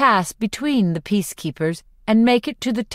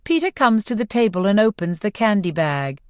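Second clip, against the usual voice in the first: ordinary delivery, nothing marked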